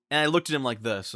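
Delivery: usual speaking voice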